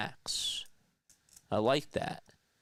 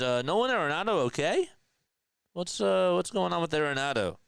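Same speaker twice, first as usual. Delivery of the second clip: pitched up, quick and puzzled; surprised; 8 semitones higher, 3 dB louder than usual. Real, not acted. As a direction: sound genuinely thrown. Surprised question about player.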